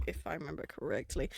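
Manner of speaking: ordinary talk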